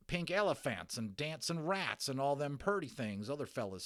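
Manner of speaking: a tipsy cowboy venting his woes; ranting Texas cowboy. Acted. Little cowboy complaining to the boy about hallucinations.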